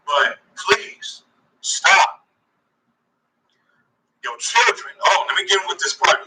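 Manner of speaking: loud fired-up sermon voice; preaching. Acted. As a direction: preach it hard at the brothers.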